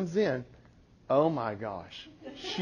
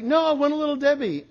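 put-on higher, fast childish demand; child refusing, higher and quicker; whiny insisting kid; 9 semitones higher, faster than usual. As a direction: insist like a pushy little boy. Son voiced insisting.

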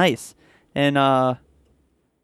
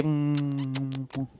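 a quick smooching sound; kissing noise; 9 dB quieter than usual. Pretend. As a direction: blow a short kiss. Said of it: "make kissing noises at each other".

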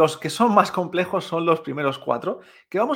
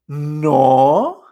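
loud, long "No", dipping then rising; astonished third tone; 11 dB louder than usual. Shown as a demonstration. Teacher demonstrating amazement about holidays, loud dipping "No". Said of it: "muestra más asombro".

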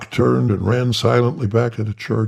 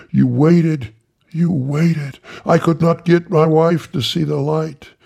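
high, breathless eager greeting; high fawning reverend; Tiny Tillman voice; 8 semitones higher, 4 dB louder than usual. Acted. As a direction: pitch it up, eager to please. Tiny at the door.

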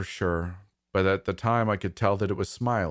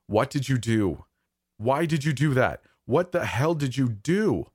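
higher-pitched, heated demands; shouting; 6 semitones higher, 3 dB louder than usual. Acted. Dad grabbing the child by the shoulders, shouting. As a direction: raise the voice and demand an answer. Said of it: "shouting in my face".

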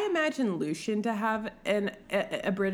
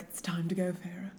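put-on lower, softer British-accented summons; 3 semitones lower, 7 dB quieter, faster than usual. Dark lord telling the bride to leave, British accent. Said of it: "I'll do the British accent".

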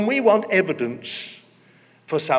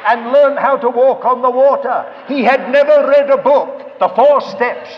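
loud, emphatic sermon delivery; full-force preaching; 2 semitones higher, 8 dB louder than usual. Real, not acted. Preacher telling how Peter walked on water, forceful preaching. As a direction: drive every phrase home to the congregation.

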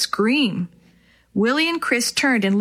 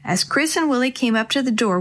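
plain reading voice

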